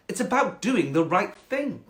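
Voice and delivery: high, haughty cat; snobby cat. Acted. Cat rebuking the frog, snobby and high. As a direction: put the frog down snootily. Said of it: "quite a snobby cat".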